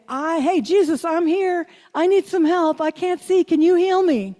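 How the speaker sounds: a blind beggar crying out to Jesus for help; calling out. Acted. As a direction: beggar calling out over a crowd, pleading.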